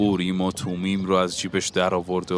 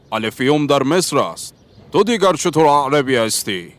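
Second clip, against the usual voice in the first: scolding retort; 6 semitones higher, 6 dB louder than usual. A narrator's reading.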